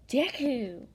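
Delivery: plain reading voice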